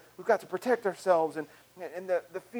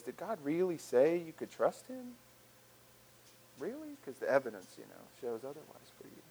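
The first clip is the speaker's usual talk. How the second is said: gently sow disbelief; insinuating doubt; 7 dB quieter than usual. Acted. Voice of doubt questioning trust in God, quietly sceptical. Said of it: "it's that voice that says".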